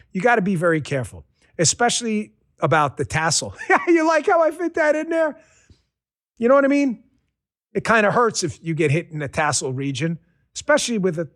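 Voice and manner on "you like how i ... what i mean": higher, laughing at his own pun. Real, not acted. Pleased with his joke to the viewers, laughing. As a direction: giggle through the question.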